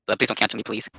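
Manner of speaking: ordinary delivery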